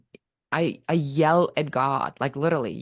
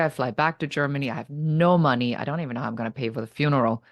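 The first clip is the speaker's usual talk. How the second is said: ordinary delivery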